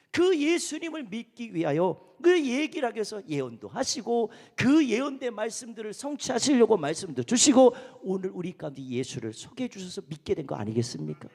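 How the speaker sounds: normal talking voice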